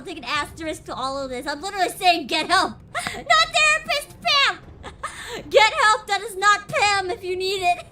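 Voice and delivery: loud, laughing through the words. Real, not acted. Streamer laughing to chat that she is no therapist, laughing loudly. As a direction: crack up while insisting.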